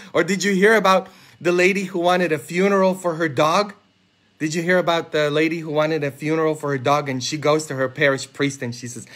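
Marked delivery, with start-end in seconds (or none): none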